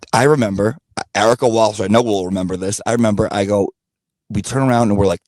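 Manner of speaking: normal talking voice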